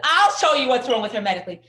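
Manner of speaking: imitating a stern pediatrician laying down the law; tough Russian doctor. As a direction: take charge like a no-nonsense doctor. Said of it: "a tough Russian".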